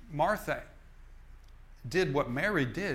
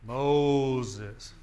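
a loud mock thundering call of Moses; booming God voice; 2 semitones lower, 6 dB louder than usual. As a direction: call it out like God from a burning bush. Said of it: "some thundering voice from the heavens".

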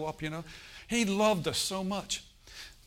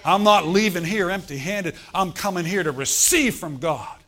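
loud, fired-up preaching vow; determined declaration; 2 semitones higher, 9 dB louder than usual. Acted. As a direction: shout it like you won't leave empty-handed.

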